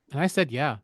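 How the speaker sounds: ordinary talk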